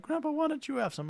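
put-on high-pitched child's question; British grandkids. Grandkids inviting grandpa to have some, high child voice. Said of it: "And they'll be like".

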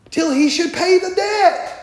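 high, loud climax of the verse; emphatic reading. Acted. Preacher reading scripture to the church, high and loud. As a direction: ring out the end of the verse.

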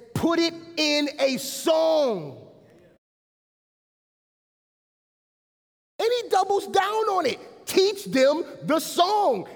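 loud, high, exclamatory sermon delivery; full-force preaching. Real, not acted. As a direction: preach it out, driving each phrase home.

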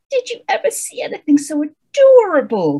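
high-pitched, gushing storybook witch; sugared-over witch. Acted. Witch showing off her hideous pet toads, sugared-over. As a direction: coo it in an exaggeratedly sugary voice. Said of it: "very sugared over".

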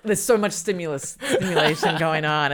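loud, laughing through the words. Real, not acted. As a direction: laugh while you say it. Laughing about sensory overload.

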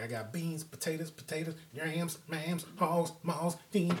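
quoting a church lady, softly lilting. Retold or imitated. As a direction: rattle off the dishes like a church lady. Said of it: "that church lady was talking about making Thanksgiving dinner".

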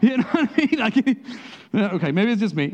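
high, loud, exasperated complaint; frustrated self. Retold or imitated. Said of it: "I can either be frustrated".

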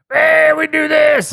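high booming Texan oil baron shout; cartoon Texan tycoon hollering; Texas oil baron. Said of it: "rich oil baron guy from The Simpsons".

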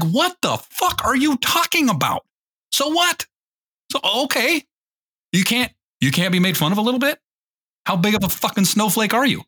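high-pitched, exasperated disbelief; incredulous mockery. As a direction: scoff at them as if baffled. Acted retort to offended men.

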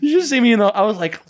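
ordinary talk

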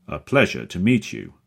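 loud, hearty booming greeting. A narrator's reading. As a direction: boom it like a showman. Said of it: "He speaks with a deep, booming voice".

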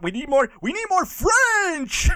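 high-pitched, hammed-up demand; demanding director. Director unhappy with the dubbed accent, hammed-up demand. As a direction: push the last word up theatrically.

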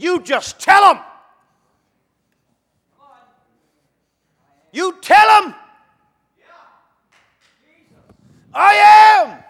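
God's answer thundered high and loud, "I am" held long; spoken with emphasis. Acted. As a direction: declare "tell him" and "I am" as a mighty shout. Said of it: "That means it's with emphasis spoken".

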